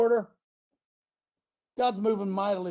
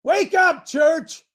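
shouting; 9 semitones higher, 7 dB louder, much slower than usual. Real, not acted. Preacher calling out to church.

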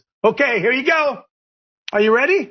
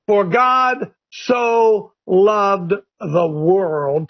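very slow, drawn-out verse, hammered on God; slow preaching read; 6 semitones lower, much slower than usual. Acted. Preacher reading scripture to his listeners, slow and emphatic. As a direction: preach each word out slowly to the congregation.

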